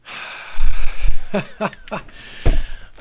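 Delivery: loud thump of a chair hitting the desk; bumping the desk. Real, not acted. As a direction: knock the desk by accident. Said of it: "I just bumped the desk with my chair".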